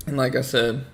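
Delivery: ordinary talk, nothing marked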